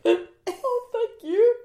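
high, teary gushing thanks; gushing thank you. Retold or imitated. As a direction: gush with mock tears. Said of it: "And I just was like".

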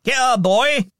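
high, cheerful call. A narrator's reading. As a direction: call the boy over. Scrooge calling a boy.

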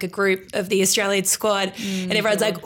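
ordinary talk, nothing marked

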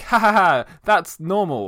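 hammed-up fake politeness; mock cheerful reassurance; fake polite laugh. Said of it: "I'll be like".

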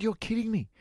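high, incredulous questions; amazed questioning. Retold or imitated. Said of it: "I said".

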